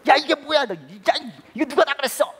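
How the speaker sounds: high, shouted demand for an explanation; angry dad. Retold or imitated. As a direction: scold the child, spluttering. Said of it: "너무 화가 나는 거예요". (화난 아빠가 다그치듯)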